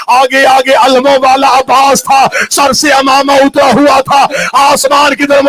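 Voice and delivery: loud, high, shouted sermon climax; full-force wailing preaching; impassioned preaching. Acted. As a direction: cry it out to the heavens.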